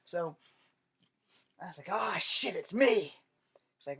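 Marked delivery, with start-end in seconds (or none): 1.9-3.1 a bit higher and louder, caught out; sheepish realization. Retold or imitated. Soldier realizing his own stink, caught-out outburst. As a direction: own up with an embarrassed burst.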